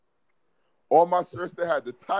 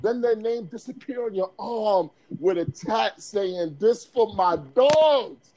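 savoring the quote; slower than usual. Retold or imitated.

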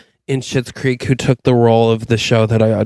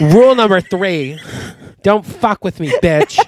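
sassy mock threat; 6 semitones higher than usual. Acted warning to the stranger.

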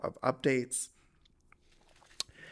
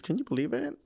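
ordinary talk

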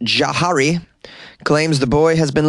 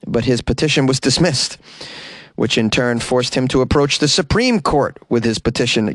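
ordinary delivery

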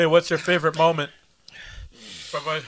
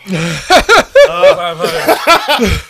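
laughing while talking; 7 semitones higher, 11 dB louder, much slower than usual. Real, not acted.

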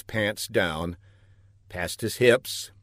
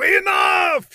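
very high, loud cut-off; furious shout; 7 dB louder than usual. A narrator's reading. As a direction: shout it to silence the scientist. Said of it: "shouted Laszlo".

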